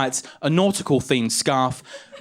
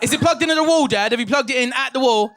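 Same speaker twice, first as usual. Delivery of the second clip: exasperated son; 7 semitones higher, faster than usual. Acted. Son answers raging dad on phone.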